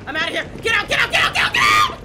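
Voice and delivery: high, loud frantic shout; panicked yelling. Real, not acted. Player fleeing mannequins, frantic yelling. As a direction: scream get out as you run.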